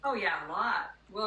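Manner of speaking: ordinary talk, nothing marked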